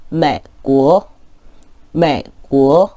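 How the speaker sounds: 매고 with 매 low and a gap before 고 rises; 반삼성 매고; half third tone again. Shown as a demonstration. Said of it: "한 번 더 읽겠습니다".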